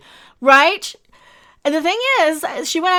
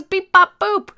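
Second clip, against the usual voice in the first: droid noise; 5 semitones higher, slower than usual. Retold or imitated. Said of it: "he goes".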